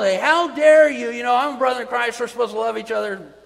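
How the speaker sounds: loud, high, rapid-fire indignant scolding; yelling. Acted. Preacher acting out a wrong way to rebuke a brother, yelling. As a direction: yell it as an outraged confrontation. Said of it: "go in there and just yell".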